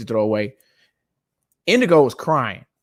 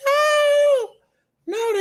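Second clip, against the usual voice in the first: high wailing cry imitation; kids wailing. Dad imitating kids wailing over thrown-out toys, crying high. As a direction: mimic kids bawling, very high. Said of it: "breaking down in the middle of the living room".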